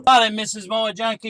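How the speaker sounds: ordinary talk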